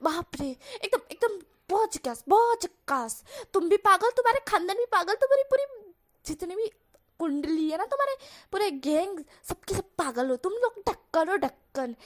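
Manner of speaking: put-on a fed-up young man telling off the whole gang; Wang Ji ranting.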